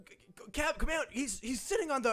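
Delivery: alarmed Ravina. Acted.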